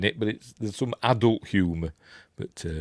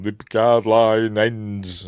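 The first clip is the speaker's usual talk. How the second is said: lightly voiced film line, drawn out; Picard; 4 dB louder, much slower than usual. Quoting a film to viewers, lightly imitating Picard. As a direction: quote it slowly, with mock gravity. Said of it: "'cause he says in this film".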